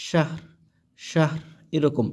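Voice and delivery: শাহর said slowly with the র clearly voiced at the end; clear final r. Shown as a demonstration. Teacher shows how শাহর ends on a clear র. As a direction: let the র in শাহর be heard.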